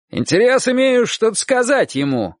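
high-pitched call from a roof; shouting. A narrator's reading. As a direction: call across the yard to make yourself heard. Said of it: "крикнул мужчина". (крик через двор)

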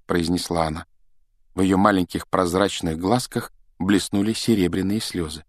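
usual speaking voice

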